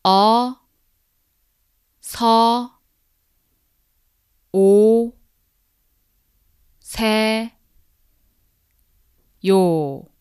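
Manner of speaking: "어, 서, 오, 세, 요" each syllable loud and separate. Shown as a demonstration. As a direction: spell out "어 서 오 세 요" one syllable at a time. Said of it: "break it into syllables so that you can repeat after me".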